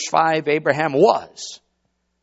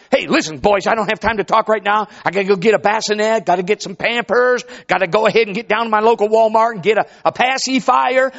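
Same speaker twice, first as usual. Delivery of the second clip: hurried Abraham; 5 semitones higher, 5 dB louder than usual. Acted. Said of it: "Excuse me, Abraham".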